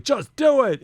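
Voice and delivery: high-pitched mock motivational yell; imitated yelling. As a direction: leap up high, as if firing someone up. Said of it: "he was just yelling".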